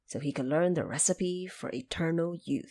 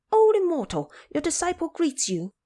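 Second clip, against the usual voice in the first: high, loud eager call; shouted greeting; 11 semitones higher, 5 dB louder than usual. Acted. Monkey King shouting a greeting to the woodcutter, high and loud. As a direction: cry out to the stranger as a hopeful disciple. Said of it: "approached and shouted".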